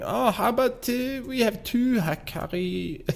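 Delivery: high-pitched mock-German voice; squeaky-high, failed German impression; bad German accent. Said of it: "why can I not do a German accent?".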